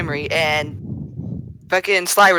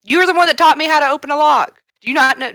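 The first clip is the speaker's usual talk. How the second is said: high-pitched, louder indignant challenge; incredulous accusation, pitched up; indignant disbelief; 10 semitones higher, 5 dB louder than usual. Acted. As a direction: throw it at them in raised-pitch disbelief. Acted disbelief at companion.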